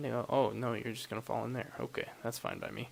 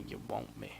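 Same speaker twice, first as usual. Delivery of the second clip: deep voice; 13 semitones lower than usual. Shown as a demonstration. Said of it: "No, I know you want me".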